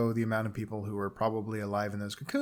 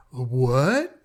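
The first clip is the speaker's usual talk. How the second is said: louder, mock shocked what; 6 dB louder than usual. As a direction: caught off guard. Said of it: "Cowan's like".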